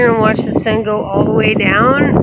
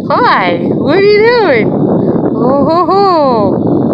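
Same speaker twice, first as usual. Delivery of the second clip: pet talk; 7 semitones higher, 5 dB louder, much slower than usual. Real, not acted. Owner greeting her dog on the beach, cooing. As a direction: coo at the pup, pitch lifted.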